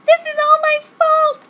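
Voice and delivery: high, loud overacted anguish; mock wailing. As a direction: wail it like a melodrama heroine. Heroine wails guilt.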